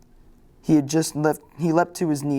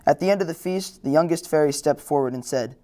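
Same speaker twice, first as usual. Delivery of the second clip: usual speaking voice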